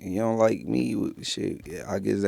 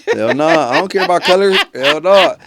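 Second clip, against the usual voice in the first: higher, louder flat no; emphatic refusal; 6 semitones higher, 5 dB louder than usual. Real, not acted. Man loudly refusing to wear short shorts, emphatic. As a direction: refuse it loud and final.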